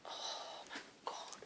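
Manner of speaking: soft, slow breathless whisper; whispering at the memory of the concert; awed whisper. Real, not acted. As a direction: hush it out in disbelief. Recalling concert in awe.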